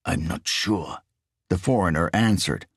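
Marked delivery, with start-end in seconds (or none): 0.0-1.0 low, quiet, bass-heavy foreign thief; deep foreign voice. A narrator's reading. Riso answers his partner's questions in a low bass voice.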